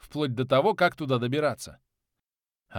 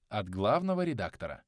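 ordinary delivery, nothing marked

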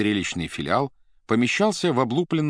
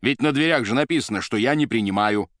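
annoyed official, raised voice; higher-pitched, indignant complaint; irritated; 4 semitones higher, 4 dB louder than usual. A narrator's reading. Boss scolds intruders at his office door, irritated.

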